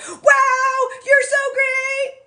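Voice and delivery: mock gushing husband, slowing and stretching the praise; imagined excited husband. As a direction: squeal it like an overjoyed husband. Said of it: "envisioning him being like".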